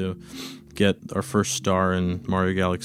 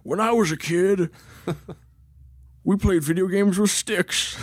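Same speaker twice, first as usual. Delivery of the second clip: put-on high creaky old-timer voice; old man voice; 9 semitones higher than usual. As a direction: play a grumpy old grandpa. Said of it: "you start using your old man voice".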